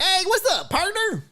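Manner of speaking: high-pitched twangy cowboy caricature; country kicker voice. Acted. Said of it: "country kicker voice".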